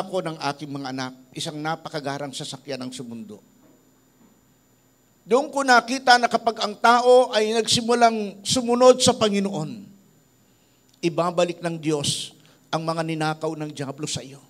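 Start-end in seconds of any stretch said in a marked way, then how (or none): 5.3-14.4 louder, higher sermon delivery building conviction; preaching. Acted. Pastor exhorting his congregation about temptation, fervent preaching. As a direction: drive the lesson home to the congregation.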